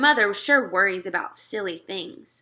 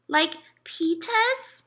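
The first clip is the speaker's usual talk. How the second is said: put-on toddler voice; 9 semitones higher, much slower than usual. A narrator's reading. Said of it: "he said".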